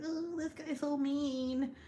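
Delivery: high, slow imitation of an upset small child; little girl. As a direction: whine it like a four-year-old. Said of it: "I don't know, four".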